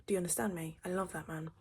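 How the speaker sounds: usual speaking voice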